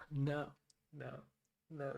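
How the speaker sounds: usual speaking voice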